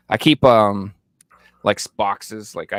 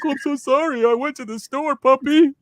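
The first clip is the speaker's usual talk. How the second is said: put-on much higher, sing-song baby talk; apologizing to the dog; 6 dB louder than usual. Owner back from the store, baby-talking to his guilt-inducing dog. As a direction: coo an apology to your puppy. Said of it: "Now I'm like".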